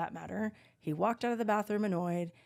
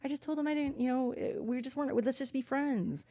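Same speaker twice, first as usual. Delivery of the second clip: higher, softer innocent voice; mock innocent; 5 semitones higher, 4 dB quieter than usual. Telling friends what happened, innocent and soft. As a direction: play it sweet and blameless. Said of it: "I go".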